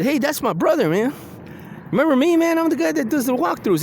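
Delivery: high, eager reassuring call; pleading explanation. Retold or imitated. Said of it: "And I said".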